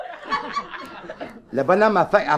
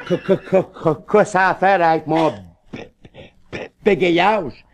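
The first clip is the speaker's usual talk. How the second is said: a lower stammering man repeating quoi; stuttering; 3 semitones lower than usual. Acted. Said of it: "et puis bégayant".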